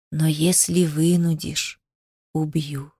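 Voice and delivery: slow, soft, low serpent voice; hissing snake. A narrator's reading. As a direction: hiss it slowly, low and hushed. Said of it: "прошипела змея". (тихое, медленное змеиное шипение)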